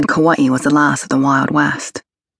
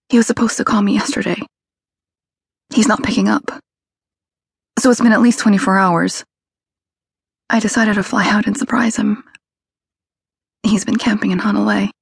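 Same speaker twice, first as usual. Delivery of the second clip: higher, breathy, near tears; breathy with a catch; 6 semitones higher than usual. A narrator's reading. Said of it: "A catch in the breathy voice".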